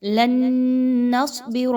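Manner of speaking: لن نصبر with the ن merged through the nose, held one beat; teacher models ghunna in لن نصبر; nasal merge. Shown as a demonstration. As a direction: hum the ن of لن into نصبر. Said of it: "ادغام ود غنہ کیجئے".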